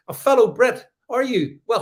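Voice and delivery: ordinary talk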